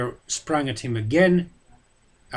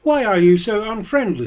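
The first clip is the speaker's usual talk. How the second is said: raised, louder voice of a proud warrior; Boromir demanding; 8 semitones higher, 7 dB louder than usual. A narrator's reading. Boromir to Frodo, raised and demanding. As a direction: press him, hurt and pushy. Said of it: "said Boromir".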